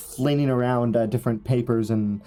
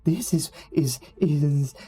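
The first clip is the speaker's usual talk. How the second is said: stammering, leaping into high pitch; high eccentric scholar, halting and rising at the end; fervent hunchback researcher; 5 semitones higher, slower than usual. Acted. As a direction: stumble over the words with obsessive excitement. Plays the hunchback Eligor.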